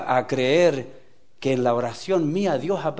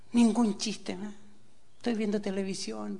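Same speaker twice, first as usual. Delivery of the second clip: soft, slightly raised lament; imitated sad woman; 4 semitones higher, 6 dB quieter than usual. Preacher quoting the joyless woman's complaint, mildly mournful.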